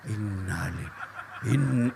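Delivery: soft, low, slow and laughing; mock recitation at wife. Shown as a demonstration. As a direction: mutter it to her face with a laugh. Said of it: "tambah marah dia".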